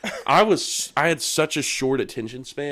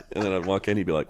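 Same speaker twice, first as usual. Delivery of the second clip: ordinary talk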